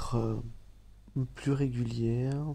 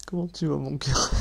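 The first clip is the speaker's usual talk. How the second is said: cheerful greeting; 3 semitones higher, 4 dB louder than usual. Real, not acted. Salut enjoué après rire.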